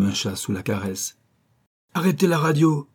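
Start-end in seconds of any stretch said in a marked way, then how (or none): 1.9-2.9 higher, punched command; curt officer command; commanding order. Acted. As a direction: snap the order at the couple. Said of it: "ordonna-t-il".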